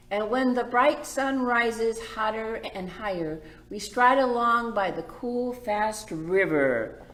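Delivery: bright, lilting story read; gentle read-aloud for the little ones; reading to children. A narrator's reading. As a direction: read it like a picture book to kids. Reads picture book aloud.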